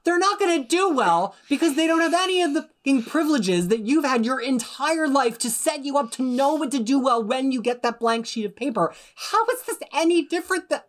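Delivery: high, heated outburst; real pissed-off rant. Real, not acted. Host ranting about test-takers without privileges, pissed off. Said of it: "Get pissed".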